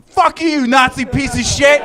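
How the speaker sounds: high, loud furious yell; angry shout. Retold or imitated. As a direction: scream it at her from a passing car. Drivers cursing the Tesla lady.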